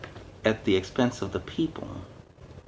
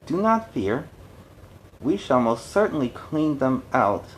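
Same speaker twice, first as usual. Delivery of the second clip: pompous mayor; 4 semitones higher than usual. Acted. Mayor placates the angry citizens, pompous and high. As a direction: soothe them with empty promises. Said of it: "being a politician to the core".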